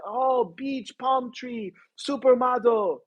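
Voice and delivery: high-pitched imitation of dreamy admirers; mock awe. People hearing Los Angeles mentioned, imitated awe. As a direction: lift the pitch and draw out the last word.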